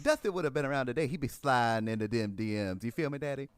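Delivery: lisping wrestler promo; swaggering Southern wrestler impression; Dusty Rhodes. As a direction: sell it like a lisping wrestler on the mic. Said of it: "do a Dusty Rhodes impersonation".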